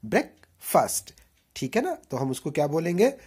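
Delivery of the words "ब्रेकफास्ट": ब्रेकफास्ट drawn out slowly, fust ending; slow correct breakfast. Shown as a demonstration. Teacher spells out ब्रेकफास्ट slowly. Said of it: "एफ़ यू एस टी बोलेंगे".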